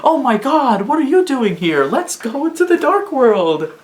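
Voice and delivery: high, excited put-on character voice; hammed-up bright greeting as a game hero; mock game character. Fan voicing a character greeting a friend, high and excited.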